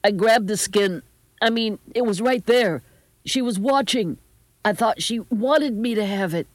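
high, distressed grandson explaining; upset, pleading voice; defensive pleading. A narrator's reading. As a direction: insist you meant no harm. Grandson frantically justifying himself.

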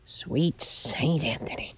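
slow, hushed, breath-heavy mutter; muttered oath. A narrator's reading. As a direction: mutter it under your breath. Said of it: "she muttered".

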